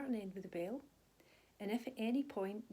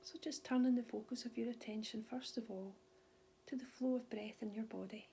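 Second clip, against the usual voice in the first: calm, unhurried body scan guidance with long pauses; soothing mindfulness teacher voice; guided meditation. Real, not acted. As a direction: gently guide them through their body, leaving space after each phrase.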